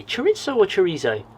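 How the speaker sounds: chorizo first as chuh-RIT-so, then chorizo as chuh-REE-zo; contrasting two pronunciations. Shown as a demonstration. Asking viewers which they say, chorizo as RIT-so or REE-zo. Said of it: "what do you guys say".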